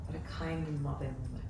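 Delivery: put-on a light French accent, a bit quick and soft; sweet French accent. Psychic imitating villagers in a light French accent. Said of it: "in the sweetest French accent".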